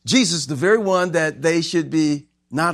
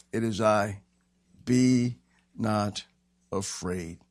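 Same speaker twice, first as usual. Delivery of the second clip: low, measured, word by word; calm reassurance; 4 semitones lower, 4 dB quieter, slower than usual. Acted. Jesus calming the disciples on the sea, low calm reassurance. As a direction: settle lower with pauses between words.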